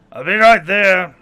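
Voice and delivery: loud guttural call from a brute; guttural creature voice. Acted. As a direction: bellow it back in a guttural voice. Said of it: "in his guttural voice".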